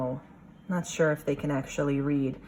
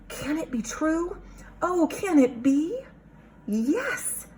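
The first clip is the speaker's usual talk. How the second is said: high, thrilled squeak of disbelief; excited bat voice; 11 semitones higher than usual. Acted. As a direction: gasp it out like an eager little bat. Excited bats in read-aloud story.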